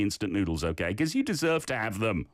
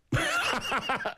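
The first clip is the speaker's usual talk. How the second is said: high-pitched helpless laugh; real burst of laughter; 12 semitones higher than usual. Real, not acted. Host amused by a student's playful ending, real laughing burst. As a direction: burst out laughing at something cute.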